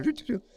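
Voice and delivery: high mock beeping like a satellite; playful imitation of radar blips; radar beeps. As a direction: beep out the radar signal, high-pitched. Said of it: "como satélites, radar".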